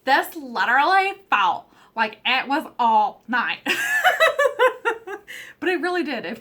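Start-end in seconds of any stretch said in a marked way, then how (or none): 0.0-3.6 laughing through "This literally felt like it was all night", louder. Real, not acted. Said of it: "I don't know why I'm talking like a Valley girl today". 3.6-5.5 loud high-pitched laugh. Real, not acted. Laughs at own Valley girl talk.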